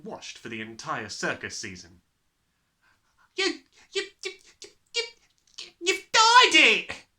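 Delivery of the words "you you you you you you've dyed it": stuttering high, bursting into a shriek. A narrator's reading. Mother discovers the dyed hair, spluttering into a shriek. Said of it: "shrieked the mother".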